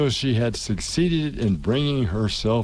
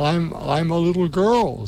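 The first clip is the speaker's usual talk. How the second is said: doubtful; 6 semitones higher than usual. A narrator's reading. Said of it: "rather doubtfully".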